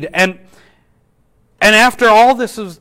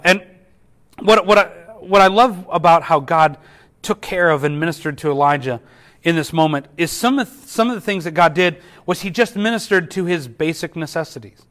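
ordinary talk

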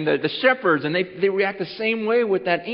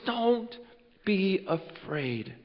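reassuring angel; 8 dB quieter, much slower than usual. Acted. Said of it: "this angel goes".

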